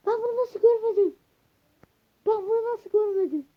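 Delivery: high, loud amazed cry; can't believe he missed it; shocked disbelief. Real, not acted. Gasps, amazed at finding diamonds. (yüksek sesle şaşkın haykırış)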